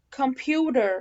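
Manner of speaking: "computer" as "kum-pyoo-der", strong R; American computer. Shown as a demonstration. Teacher drilling American examples, flapped T. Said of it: "ده كده امريكان".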